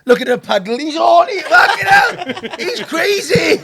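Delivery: loud, high, laughing exclamations; hammed-up shrieks through laughter; laughing outburst. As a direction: play a scandalised onlooker while cracking up. Acts onlookers, laughing at the scene.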